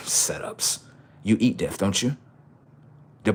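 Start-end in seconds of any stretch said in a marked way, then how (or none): 0.1-0.8 whispering. Real, not acted. Whispered aside after laugh.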